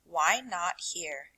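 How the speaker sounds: plain reading voice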